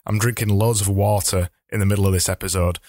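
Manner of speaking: normal talking voice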